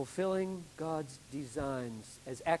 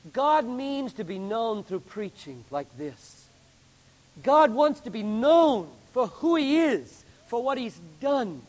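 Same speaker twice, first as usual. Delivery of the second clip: loud, raised preaching voice; full-force preaching; 7 semitones higher, 9 dB louder, slower than usual. Acted. Preacher driving the point home to his congregation, loud and fervent. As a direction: pound the point home from the pulpit.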